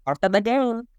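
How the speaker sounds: high, playful catchphrase; sing-song catchphrase. Acted. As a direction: toss it off lightly, sing-song.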